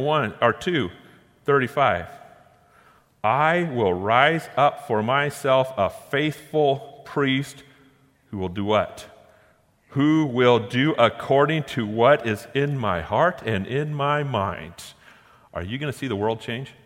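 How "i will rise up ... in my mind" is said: slow, deliberate reading of a verse; emphatic scripture reading. Acted. Preacher reads God's promise to the congregation, slow and emphatic. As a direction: weigh out each phrase slowly.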